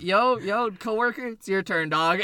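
loud, high hail to a coworker; calling out. Retold or imitated. Cart pusher handing the problem to a coworker, calling out. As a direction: call out urgently, imitating yourself.

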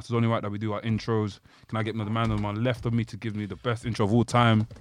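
normal talking voice

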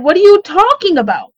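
high-pitched incredulous question; retold disbelief. Sister hearing of abandonment from the state, retold disbelief. Disbelieving reply to shocking news.

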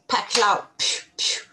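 loud pow then whispered pew pew; cartoon crash noises. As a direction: spit out comic-book crash sounds. Sudden collapse sound effect.

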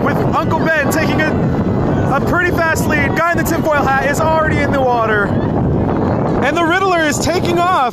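excited play-by-play announcer; race commentator. Pretend. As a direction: call the race like a sportscaster.

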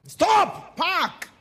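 barking police orders; loud stop command; shouted command. Retold or imitated.

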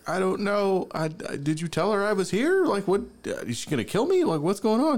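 high-pitched, bewildered protest; mock panic. Terrified guest asks friend if grandma will kill him, panicked.

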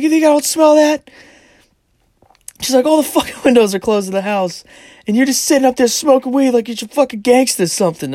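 high, loud furious mom yell; angry mom. Retold or imitated. Mom snapping at her smartass son, loud and high. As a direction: bite his head off, high and loud.

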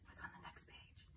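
hushed, secret page-turn whisper. Acted.

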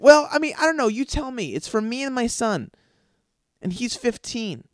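high, loud ditzy mom voice; hammed-up mom dodging the question; mocking mom. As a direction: play her as clueless and flustered. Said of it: "she backtracks and goes".

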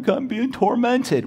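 high, slow mock whine; whiny complaint, high and drawn out. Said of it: "Some Christians just, "Well".

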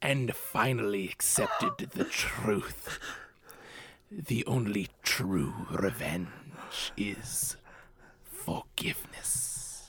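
hushed, slow dramatic whisper; poetic reading voice. Shown as a demonstration. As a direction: breathe each word like solemn verse. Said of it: "my most poetic voice possible".

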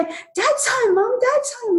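put-on high, bright children calling out; excited kids. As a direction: voice the kids shouting happily that dad's home. Kids announcing father home.

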